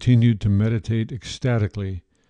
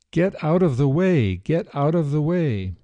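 higher-pitched shouted warning, narrator's restraint; mild shouting; 6 semitones higher than usual. A narrator's reading. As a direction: call out insistently, without full force. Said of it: "becoming louder and louder as he shouted".

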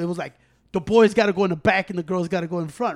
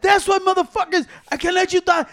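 high, loud scolding dad; 10 semitones higher, 5 dB louder than usual. Retold or imitated. Porfi scolding, retold.

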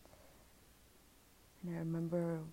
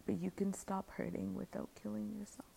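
higher, unvoiced whisper; whispered comfort; 5 semitones higher, 7 dB quieter than usual. Inner voice comforting her, a whisper. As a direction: breathe the reassurance.

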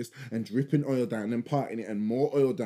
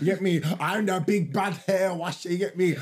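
higher, slow, swaggering mock voice of his partner; mock proud hair pro; 6 semitones higher, slower than usual. Mocking his partner's pride in doing hair, higher and swaggering. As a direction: puff up like the big bad hair washer. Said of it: "I know in her head".

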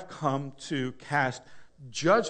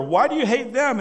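talk show host; 7 semitones higher, 5 dB louder than usual. Pretend. Host interviewing talk show guests.

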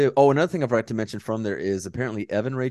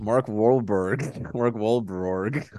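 laughing slow echo; much slower than usual. Retold or imitated. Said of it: "The, the Mark what?".

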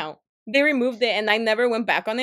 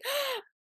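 gasp; 6 dB quieter than usual. Retold or imitated. Reenacted shocked gasp.

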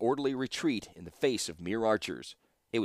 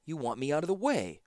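accusing; 6 semitones higher than usual. A narrator's reading. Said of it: "accused Ashnod".

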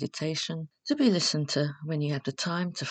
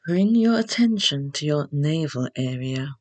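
guided meditation; 4 dB louder than usual. Acted. Host leading beginners through Yoga Nidra, calm guided meditation. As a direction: guide them unhurriedly into rest, phrase by phrase.

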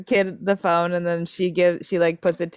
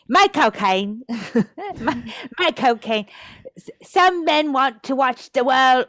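a playful, slightly raised attempt at a Michael Caine accent; trying Michael Caine; 4 semitones higher than usual. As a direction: friend tries the accent trick, half-laughing. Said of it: "I'm gonna try this trick".

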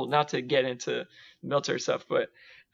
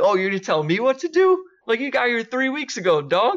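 high, louder mock outrage; indignant comeback; 8 semitones higher, 3 dB louder than usual. The speaker snapping back at a newcomer, indignant. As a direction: put the new guy in his place. Arguing back at a junior Marine.

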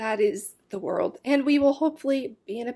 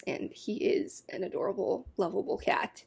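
soft comforting; 8 dB quieter than usual. Real, not acted. Comforting friend about dying cat.

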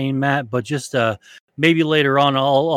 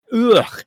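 disgusted ugh; 10 semitones higher, 4 dB louder than usual. Real, not acted. Disgusted by hair in sinus.

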